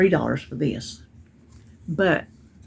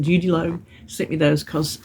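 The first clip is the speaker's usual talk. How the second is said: ordinary delivery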